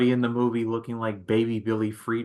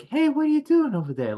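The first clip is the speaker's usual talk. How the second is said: much higher mocking Wahlberg voice; Mark Wahlberg voice; 3 dB louder, faster than usual. Mocking Mark Wahlberg's voice for a friend, higher. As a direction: ham up his famous line. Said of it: "we make fun of the voice".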